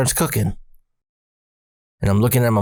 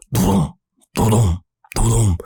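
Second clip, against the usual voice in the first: imitated heartbeat; much slower than usual. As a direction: voice a pounding heart in paired beats. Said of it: "I started hearing my heart pound".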